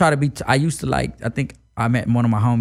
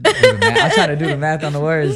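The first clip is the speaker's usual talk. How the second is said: real laughter in the voice, raised and tickled; laughing; 5 semitones higher, 6 dB louder than usual. Real, not acted. Rapper stumped by the interviewer's three-word question, laughing. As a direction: talk through a laugh.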